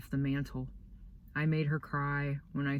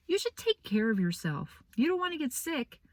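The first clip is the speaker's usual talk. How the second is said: much higher, fussing teacher voice; concerned teacher; 9 semitones higher than usual. Retold or imitated. Boy voicing Missus B asking about his coat, high fussy voice. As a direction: mimic a worried teacher.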